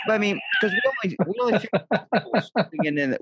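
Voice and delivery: high, fast, put-on mom voice; mom's pleading voice. Mom coaxing her son to sing, a high, fast put-on voice.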